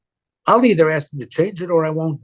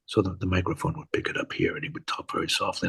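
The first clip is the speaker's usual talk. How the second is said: low, hushed voice held close to the mic; very soft talk; 8 semitones lower, 10 dB quieter, faster than usual. Shown as a demonstration. Actor shows how his co-star talked on set, very softly. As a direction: drop it right down and let the microphone pick it up. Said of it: "He would talk so softly".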